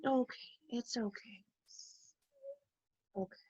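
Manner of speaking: whispered soothing. Real, not acted. Soothing fussy toddler.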